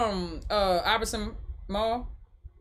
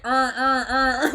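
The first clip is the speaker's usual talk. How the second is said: every word flattened to "urn", Baltimore style; imitated Baltimore accent; 3 semitones higher, slower than usual. Streamer recalling a viral clip, mock Baltimore accent. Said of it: "And it was like".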